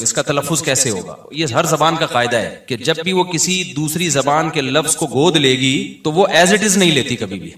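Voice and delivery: ordinary talk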